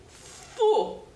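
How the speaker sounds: Fu said alone as one syllable; first syllable alone. Shown as a demonstration. Teacher isolating the syllable Fu for children. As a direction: say just the syllable Fu slowly. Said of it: "a primeira sílaba".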